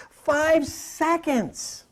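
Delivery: very slow, stretched out; slow, stretched emphasis; drawn-out emphasis. Real, not acted. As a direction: savour each word slowly.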